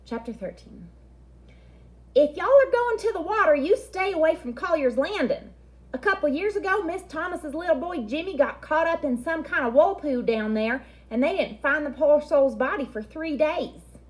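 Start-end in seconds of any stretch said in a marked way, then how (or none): 2.1-14.0 put-on much higher, louder old Southern grandma warning; southern grandma accent. Grandma Sands warning the kids off the water, Southern accent. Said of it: "because she has such a southern accent".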